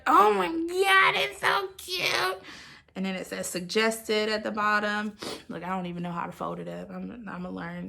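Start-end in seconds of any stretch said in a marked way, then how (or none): 0.1-2.4 retold squeal. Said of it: "I was like".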